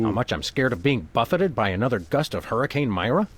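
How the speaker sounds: usual speaking voice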